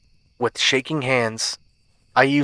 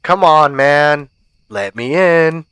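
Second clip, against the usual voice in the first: put-on pushy teen voice, raised and loud; teenage boy; 3 semitones higher, 6 dB louder, slower than usual. A narrator's reading. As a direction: badger them like an impatient teen. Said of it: "said a teenage boy".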